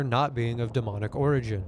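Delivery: ordinary delivery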